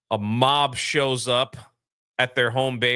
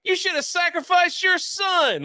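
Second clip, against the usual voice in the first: angry crowd. Retold or imitated. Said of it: "They're all like".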